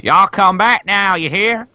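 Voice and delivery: high, drawn-out hammed-up American southern drawl; American accent. Imitating a Futurama character on the moon, American accent. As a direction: do a cartoonish American twang, stretched out. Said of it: "That's, that's my American accent".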